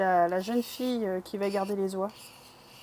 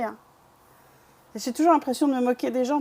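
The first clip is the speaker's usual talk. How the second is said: usual speaking voice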